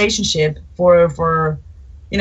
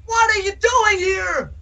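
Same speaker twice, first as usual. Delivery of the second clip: mock panic; 11 semitones higher than usual. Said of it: "I was like".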